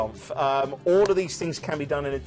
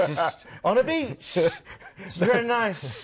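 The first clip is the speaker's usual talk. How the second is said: slow, higher, woozy pirate drawl with a laugh; Jack Sparrow; 5 semitones higher, slower than usual. Pretend. Dreaming of the Caribbean, as a slow drawling pirate. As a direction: loose pirate captain lazing on a beach, slowed right down. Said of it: "That doesn't sound like Jack Sparrow at all".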